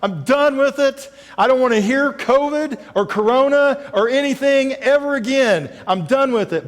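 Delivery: mock an exasperated churchgoer done with covid; fed-up complaint. Pastor voicing frustrated covid-weary listener, fed up. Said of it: "maybe you're on the side that goes".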